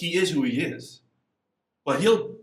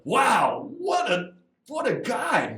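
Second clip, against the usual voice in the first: mock awe; 10 semitones higher, much slower than usual. Speaker mocks awed reaction.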